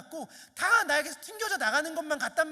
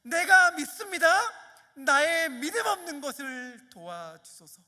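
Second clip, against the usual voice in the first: louder, higher cry of faith; shouted prayer; 4 semitones higher, 8 dB louder than usual. Shown as a demonstration. Preacher shows the youth how to cry out, louder and higher. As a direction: shout it to God. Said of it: "질러야 해요".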